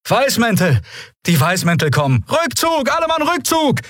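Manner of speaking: very high-pitched calls of alarm; alarmed shouts. A narrator's reading. Pirates calling retreat as the Whitecoats come, alarmed. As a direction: cry out a retreat, voice pitched far up. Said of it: "hörten sie die Rufe".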